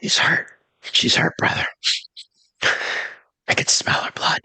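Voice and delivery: hushed, urgent whisper. Acted. Syl whispers about wounded doe.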